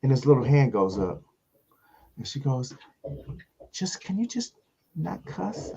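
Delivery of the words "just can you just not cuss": soft, high imitation of a woman; timid request. Hostess asks the comic to clean up, timid. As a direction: ask delicately in a small high voice.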